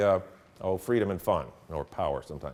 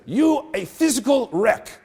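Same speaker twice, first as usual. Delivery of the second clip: higher, slow, odd-voiced sensei verdict with a laugh; blunt sensei; 12 semitones higher, 6 dB louder, slower than usual. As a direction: deliver the insult slowly like a stern karate master. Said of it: "He said".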